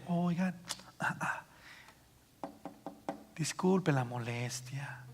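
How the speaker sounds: hushed, hesitant, slow near-whisper; timid apology. Shown as a demonstration. Meek speaker bothers the crowd, timid apology. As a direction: shrink back and barely get it out. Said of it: "No, no, me, me habla de que Pedro se levantó".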